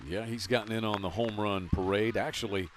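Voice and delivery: ordinary talk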